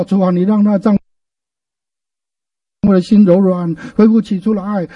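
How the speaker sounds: slow, drawn-out prayer voice; a slow pleading prayer for the husband; earnest slow prayer. Shown as a demonstration. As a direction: pray it tenderly and unhurried. Demonstrates praying for husband.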